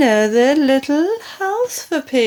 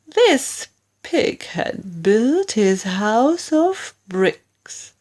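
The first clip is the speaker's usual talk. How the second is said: usual speaking voice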